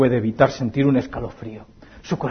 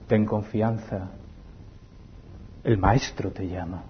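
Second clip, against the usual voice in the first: reassuring; 7 semitones lower, 11 dB quieter than usual. Acted. Stranger comforts the blind man.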